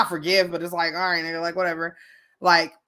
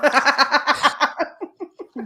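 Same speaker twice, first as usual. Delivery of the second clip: loud laugh; 8 semitones higher, 5 dB louder than usual. Real, not acted. Laughing at own joke.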